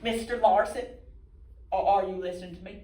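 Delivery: high, loud call to attention; impatient focus group leader. Acted. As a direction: cut in loudly on a daydreamer. Leader calls out daydreaming Larson.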